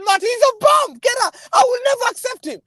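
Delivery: high mock shout at Martin; hammed-up retold rant at a friend; retold outburst. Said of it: "I said".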